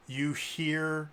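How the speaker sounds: ordinary delivery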